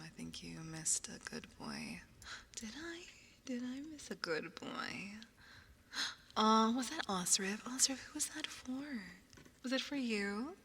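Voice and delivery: cooing unvoiced whisper. Real, not acted.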